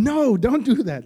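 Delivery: high, loud pretend yell at the TV; mock shout at screen. Viewer yelling at the TV screen, loud mock shout. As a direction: shout a warning at a movie character. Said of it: "we'd probably be shouting at that screen".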